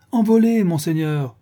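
Igor cuts in, high and loud on Envolé; eager interruption. A narrator's reading. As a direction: blurt it out, then ease off on monseigneur. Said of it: "interrompit vivement Igor".

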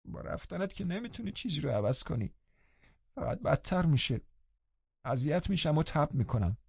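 high, soft, peevish complaint; sulky, impatient. A narrator's reading. Linton turning away from Catherine, ill-tempered and impatient. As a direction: grumble like a cross invalid, high and soft.

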